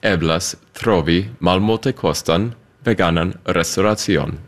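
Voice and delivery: "Kie eblas trovi malmultekostan veganan restoracion" slowly, word by word; careful, spaced-out "Kie eblas trovi malmultekostan veganan restoracion"; pronunciation demo. Shown as a demonstration. Guest repeating "Kie eblas trovi malmultekostan veganan restoracion" for host, slow demo. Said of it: "ask you to say that again".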